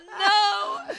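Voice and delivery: high, loud, drawn-out comic wail; horrified cry. Retold or imitated. DJ realising he played the wrong song, horrified shout. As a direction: shout it in mock horror at your blunder.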